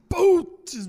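loud, high burst of dismay; exclaimed reaction. Retold or imitated. Comedian voicing a reaction to his own jokes, loud exclamation. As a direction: blurt it out high and loud, as if wincing at a joke. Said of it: "assim, que você fala".

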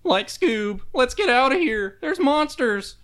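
high cracking Shaggy voice, panicky; scared stoner drawl like Shaggy. Acted. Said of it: "That was a really good Shaggy".

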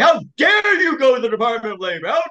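shouting judge. Retold or imitated. Judge yelling at the lawyer for going to Labor, high angry shout. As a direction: bellow it as an outraged judge. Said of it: "He was shouting at me".

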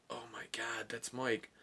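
very soft, stunned murmur; hushed shock. Retold or imitated. Friends recognising injured Mike in the ambulance, hushed shock. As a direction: drop to a hushed aside in disbelief.

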